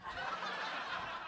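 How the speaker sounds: soft, unvoiced chuckle; breathy laugh. Real, not acted. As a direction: laugh quietly to yourself. Laughing at own joke.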